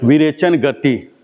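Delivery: slow, syllable by syllable विरेचन गति; slow, clear teacher model; slow naming for repeating. Shown as a demonstration. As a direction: spell out विरेचन गति slowly so the class can repeat. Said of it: "आप लोग बोलिए".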